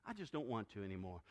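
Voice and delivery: low, soft, mock-reluctant; reluctant excuse. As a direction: voice a believer losing interest, low and quiet. Acted excuse of flagging churchgoer.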